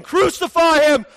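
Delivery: high, loud chant that slows at the end; crowd shouting. Retold or imitated. Imitating the mob calling for crucifixion, high loud chant. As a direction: hammer each cry like an angry mob. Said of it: "the crowd was shouting".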